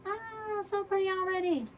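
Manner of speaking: high-pitched, delighted squeal of admiration; delighted gushing. Real, not acted. Crafter admiring her flower arrangement, high delighted gush.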